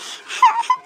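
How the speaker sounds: high nervous giggle. Acted. Embarrassed after gown slips.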